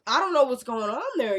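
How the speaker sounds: ordinary talk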